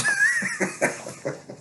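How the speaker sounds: a sudden high-pitched cackle; high cackling laugh. Real, not acted. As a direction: burst into a squeaky laugh. Laughing at his own story.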